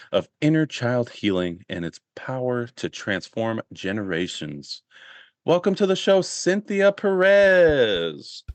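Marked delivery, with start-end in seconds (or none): 5.5-8.4 big announcer welcome, name stretched out; louder, higher, drawing out the guest's name; show host introduction. Real, not acted. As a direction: bring the guest on with a drawn-out flourish.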